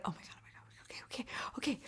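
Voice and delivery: barely audible panicky whisper; whispered self-pep-talk. Retold or imitated. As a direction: whisper it to psych yourself up. Psyching herself up in her head.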